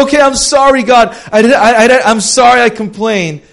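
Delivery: high, loud, stammering plea; frantic apology. Retold or imitated. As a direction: beg God's pardon in panic. Elijah pleading for forgiveness.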